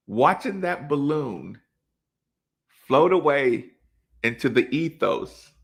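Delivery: normal talking voice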